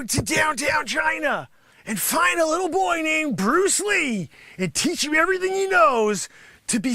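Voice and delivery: high, loud indignant shouting; indignant rant. Acted. Sensei Round Eye yelling at doubters about Bruce Lee. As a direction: rant like a wounded master. Sensei ranting at being questioned.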